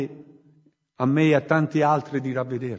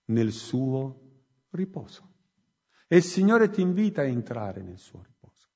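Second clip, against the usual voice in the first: softer, lower, gentle altar-call voice; quiet heartfelt appeal; 5 semitones lower, 4 dB quieter than usual. Acted. Preacher inviting each listener to open their gates, hushed appeal. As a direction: draw them in softly, one by one.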